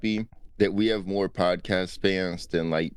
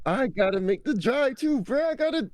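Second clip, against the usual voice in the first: much higher, complaining mock voice; whiny complaining friend; 12 semitones higher than usual. Comic voicing a friend whining about the drive, high and whiny. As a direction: whine it up high like a lazy friend.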